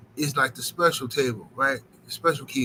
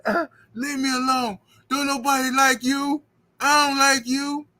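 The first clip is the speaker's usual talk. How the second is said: a lighter mocking take on the girl; mock girl putdown; 9 semitones higher than usual. Repeating the girl's putdown to the chat, lightly mocking. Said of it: "this girl got up and said that".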